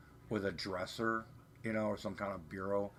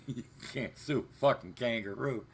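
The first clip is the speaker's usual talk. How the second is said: normal talking voice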